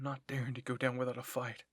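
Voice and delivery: ordinary delivery, nothing marked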